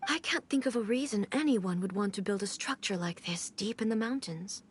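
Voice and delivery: normal talking voice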